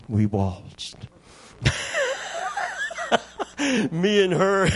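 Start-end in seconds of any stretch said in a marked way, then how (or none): 1.6-3.9 very high burst of real laughter. Real, not acted. Preacher laughing at his dog snuggling in, very high. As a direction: break into a high delighted laugh.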